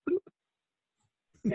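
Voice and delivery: high, small comic bloop; a tiny cartoon drop noise; bloop sound. Only a drop of spit comes out: high silly bloop.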